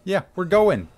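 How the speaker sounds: loud, breathless panicked calls; being chased. Real, not acted. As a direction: yell in fear while fleeing. Player chased through house.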